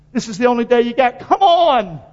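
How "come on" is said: urging call. Real, not acted. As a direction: rouse the room. Preacher urging congregation.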